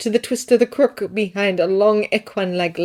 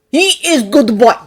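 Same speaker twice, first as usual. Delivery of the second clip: put-on louder, heavy Russian-accented Santa; a big man's Russian-accented agreement; 5 semitones higher, 9 dB louder than usual. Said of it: "Nick agreed".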